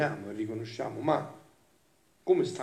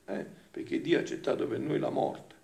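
normal talking voice

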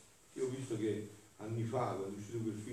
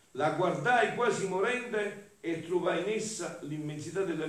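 louder, higher declamation easing toward the end; impassioned devotional reading; 5 semitones higher, 6 dB louder than usual. Acted. Preacher reads of the Mother supporting dying Jesus, fervent declamation. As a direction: proclaim it phrase by phrase.

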